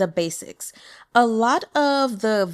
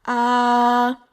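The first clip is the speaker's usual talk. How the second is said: long "Ah" held level, louder; straight first tone; 3 semitones higher, 5 dB louder than usual. Shown as a demonstration. Teacher demonstrating Mandarin first tone, straight exaggerated "Ah". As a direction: sustain "Ah" on one even note, exaggerated. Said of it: "going to be a straight tone".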